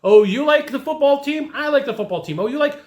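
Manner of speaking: high, eager echoes of the buyer's likes; mock eager rapport-builder. Mocking a salesperson's copycat small talk, high and eager. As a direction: parody the salesperson who copies everything. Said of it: "it's all about".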